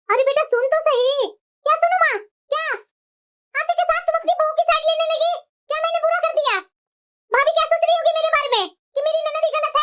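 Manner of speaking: huffy married daughter; offended daughter. Acted. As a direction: sulk and storm out. Daughter takes offence, storms off.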